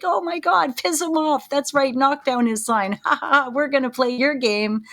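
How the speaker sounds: put-on high-pitched excited kid, egging on; gleeful kid. Kid cheering on a parent against the game, gleefully mischievous.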